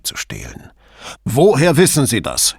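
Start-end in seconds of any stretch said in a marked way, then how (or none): 1.2-2.5 sharp, raised demand; snapping. A narrator's reading. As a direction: bark the question. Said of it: "herrschte er ihn an".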